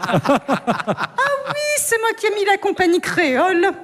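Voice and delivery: put-on high giggly drunk guest chattering on; high, laughing, clueless party voice; tipsy Catherine. Drunk Catherine at the wrong party, high and giggling. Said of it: "Je me mets en mode Catherine Fro".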